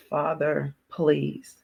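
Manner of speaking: soft, barely voiced plea; hushed prayer. Woman with a gun to her head, softly begging God.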